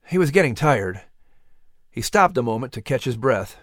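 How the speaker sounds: usual speaking voice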